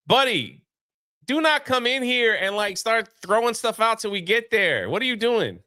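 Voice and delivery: scolding a friend who won't wait; mock scolding. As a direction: hold them back from rushing ahead. Voicing a character scolding someone.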